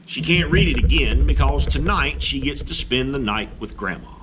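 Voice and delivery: ordinary delivery, nothing marked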